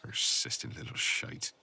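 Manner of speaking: whispered under the breath, slow and annoyed; muttered aside. Acted. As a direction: grumble it to yourself, barely voiced. Annoyed muttered aside after caller.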